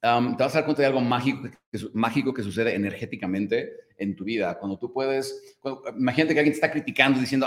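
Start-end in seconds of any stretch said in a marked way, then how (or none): none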